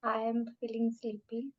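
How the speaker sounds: very slow drowsy repetition; slow sleepy repeating. Shown as a demonstration. Student repeating the sleepy mantra, very slow. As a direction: drag each word as if half asleep. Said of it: "अपने आप को ये बात कम से कम दस बार बोलो".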